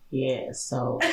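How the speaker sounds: low, soft, slow deadpan imitation; drawn-out unbothered friend; Fatima's slow voice. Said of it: "Fatima would be like".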